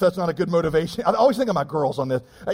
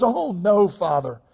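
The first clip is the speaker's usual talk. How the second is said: put-on high, slow, syrupy little girl's voice; a sweet daughter overdoing her piety, pitched up; pious little daughter; 4 semitones higher, 5 dB louder, slower than usual. Daughter thanking Daddy for ice cream, mock saintly and high. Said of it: "one of my little girls looks back up at me and says".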